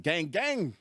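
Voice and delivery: high, hearty imitation of a big guy's greeting; hyped greeting. Buff dude greets him in the green room, hyped.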